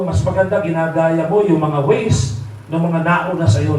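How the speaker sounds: ordinary delivery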